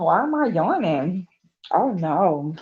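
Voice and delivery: usual speaking voice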